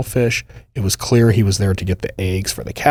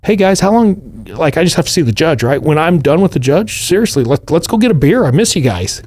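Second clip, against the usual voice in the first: high, hopeful, friendly pleading; pleading Fedor; 5 semitones higher than usual. Retold or imitated. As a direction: coax your old buddies into a beer. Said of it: "he's still like".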